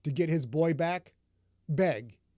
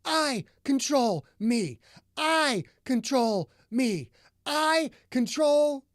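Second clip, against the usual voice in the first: high, loud and slow, every word hit; each word emphasized; 8 semitones higher, 5 dB louder, slower than usual. A narrator's reading. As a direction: hammer out each word, slapping the chest. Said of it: "emphasizing each word".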